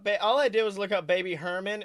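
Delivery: ordinary talk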